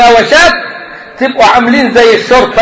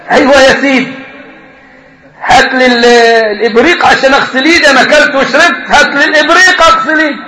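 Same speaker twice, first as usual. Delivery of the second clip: normal talking voice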